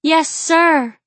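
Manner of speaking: high, loud obedient cry; ecstatic cry. A narrator's reading. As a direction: cry it out with abandon. Woman obeys at peak of passion.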